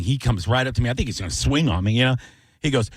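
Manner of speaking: usual speaking voice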